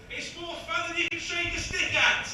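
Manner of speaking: high, loud punchline ending in a yell; triumphant high-pitched crowd-facing shout. Real, not acted.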